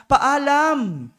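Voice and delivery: high, loud "Paalam"; shouted goodbye. Acted. Boy calling "Paalam" to father, shouted. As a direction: call out "Paalam" to him.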